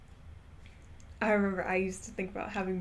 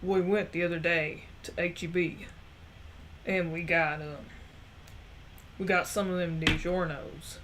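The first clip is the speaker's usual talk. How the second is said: imitating great-grandfather's soft Texan accent; old Southern grandpa telling a story, a little low; softer Southern accent; 3 semitones lower than usual. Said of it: "he had a softer accent".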